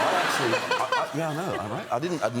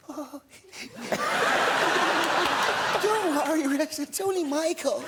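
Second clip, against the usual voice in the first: [name] voice; 4 dB louder, slower than usual. Retold or imitated. Prank caller revealing himself to Rex, high and giggly. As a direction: mimic him breaking into laughter mid-prank. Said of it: "put on funny voices".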